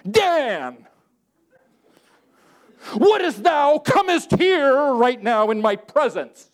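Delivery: high, slow, hammed-up old-English grandeur; mock King James speech. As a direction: summon Dan like a pompous Bible reading. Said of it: "we think that we have to talk King James".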